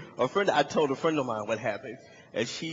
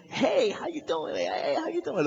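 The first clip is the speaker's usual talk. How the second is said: high, sing-out tipsy greeting; mock party-crowd hello, pitched way up; merry partygoers; 11 semitones higher than usual. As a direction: greet like a giggly passing couple. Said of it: "they're giggling and they're laughing".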